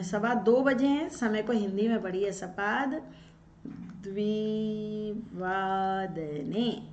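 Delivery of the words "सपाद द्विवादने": द्विवादने stretched out very slowly with a rising end; slow dictation of द्विवादने; slow demo. Shown as a demonstration. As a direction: drag out द्विवादने syllable by syllable so students write it. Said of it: "इस प्रकार".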